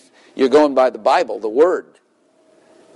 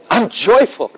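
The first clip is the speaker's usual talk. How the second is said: high, hammed-up joyful cry; exultant joy; 10 semitones higher, 3 dB louder, slower than usual. Jim finally says it as God told him, joyfully. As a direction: throw it upward like hands in the air. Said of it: "Throw your hands up when you say it".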